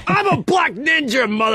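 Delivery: high, hammy cop voice. Acted. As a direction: bark it like a cartoon cop. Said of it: "me as a cop that sounds a lot like me".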